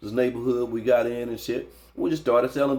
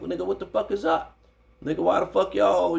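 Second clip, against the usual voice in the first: confrontational challenge; 7 semitones higher than usual. Retold or imitated. Confronting rival crew.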